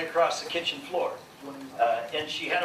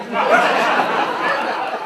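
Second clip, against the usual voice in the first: big laugh; 9 dB louder than usual. Real, not acted. Laughs at own punchline.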